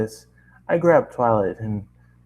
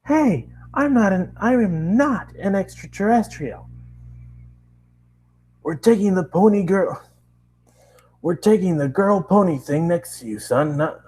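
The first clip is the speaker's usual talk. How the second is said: protesting narrator; 9 semitones higher than usual. Acted. Narrator argues with police.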